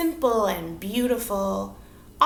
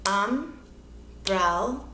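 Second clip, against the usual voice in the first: "Umbrella" broken slowly into separate syllables, um, brel, la; 3 dB quieter, much slower than usual. Shown as a demonstration. Teacher showing children the syllables, "Umbrella" split slowly into three parts. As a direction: say "Umbrella" in three slow chunks with pauses. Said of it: "We can hear them".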